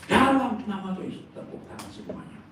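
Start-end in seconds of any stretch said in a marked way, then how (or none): 0.1-1.3 loud, high prayer cry; forceful invocation. Retold or imitated. Preacher repeats prayer over sick man, loud and forceful. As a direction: proclaim the holy name with force.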